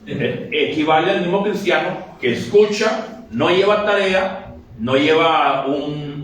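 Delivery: ordinary delivery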